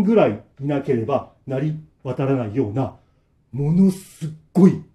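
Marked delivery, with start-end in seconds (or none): none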